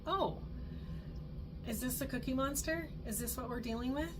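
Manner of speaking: high, bright playful question; playful surprise. Retold or imitated. Parent naming the meltdown to the child, playful high voice. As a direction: tease the kid gently with the name.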